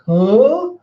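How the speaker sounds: high, loud rising huh; exaggerated baffled reaction; incredulous huh. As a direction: blurt it in mock disbelief. Gifters' acted disbelief.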